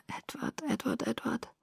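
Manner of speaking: very soft, hushed plea; desperate whisper. A narrator's reading. Girl about to die calls on Edward, desperate whisper. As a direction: whisper the name like a last thought. Victim's desperate thought of her love.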